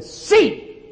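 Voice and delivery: sudden high cry; commanding cry. Retold or imitated. Preacher commanding the blind woman to see, high cry. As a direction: fling out the command.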